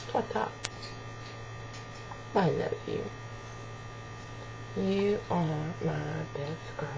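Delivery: hushed loving coo; soft cooing. Real, not acted. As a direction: croon it close and tender. Owner coos to her parrot.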